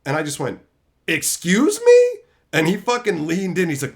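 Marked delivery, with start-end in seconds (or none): 1.1-2.2 very high, loud, slow outraged question; indignant excuse me. Retold or imitated. Confronting the manager who called him out, slow outraged disbelief. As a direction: stretch it out in disbelief.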